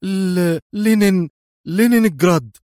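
high, stammering, frightened answer; trembling voice. A narrator's reading. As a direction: stutter it out through choking fear. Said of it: "قائلا بصوت مرتجف". (بصوت مرتجف متلعثم)

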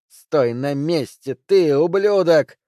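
slow, loud, high yell. A narrator's reading. Woman yells at the fleeing man to stop. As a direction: bark the order after him.

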